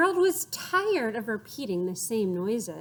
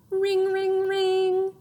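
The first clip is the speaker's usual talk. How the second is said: higher loud ringing; 9 semitones higher, 5 dB louder, much slower than usual. Shown as a demonstration. Harold imitating the alarm clock.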